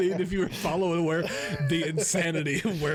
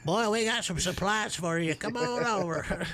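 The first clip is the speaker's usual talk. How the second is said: hammy southern twang, pitched up; southern [name] voice; 3 semitones higher than usual. Pretend. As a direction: invite them over in mock southern. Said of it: "talk like this the whole time".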